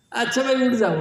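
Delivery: called out high and loud: fly away; loud command to birds. Retold or imitated. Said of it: "जैसे उन्होंने कहा".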